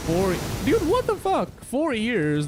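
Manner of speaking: high, loud disbelief; shocked outburst. Real, not acted. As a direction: blurt it out, stunned. Shocked at four years.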